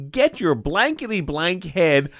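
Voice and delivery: high, slow, barked rebuke from the surgeon; angry surgeon. Retold or imitated. Surgeon knocking resident off his chair, angry bark. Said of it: "and says".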